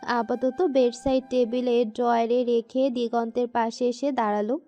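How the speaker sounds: usual speaking voice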